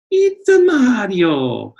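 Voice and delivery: Mario voice. Retold or imitated. Mario impression.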